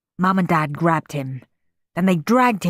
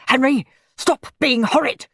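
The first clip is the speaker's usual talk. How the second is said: a hint of a louder scolding dad; yelling father; 7 semitones higher, 4 dB louder than usual. A narrator's reading. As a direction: scold the boy. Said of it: "yelled Dad".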